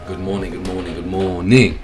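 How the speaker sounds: usual speaking voice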